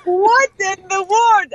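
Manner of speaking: high, slow, laughing what in the world; laughing disbelief. Retold or imitated. Vivian hearing fans missed her, slow laughing disbelief. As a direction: stretch it out through laughter.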